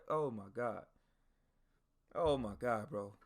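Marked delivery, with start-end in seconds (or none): none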